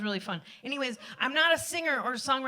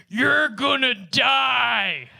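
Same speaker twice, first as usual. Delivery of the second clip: slow, loud, stern warning; sternest voice; 7 dB louder, much slower than usual. Retold or imitated. To her partner about to jump in the water, stern slow shout. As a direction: lay down the law to stop her. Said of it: "I had to say in my sternest voice".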